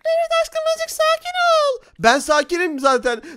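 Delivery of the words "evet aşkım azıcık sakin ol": put-on girly high spider girl voice. Spider girl calms him. (çok ince sesli örümcek kız)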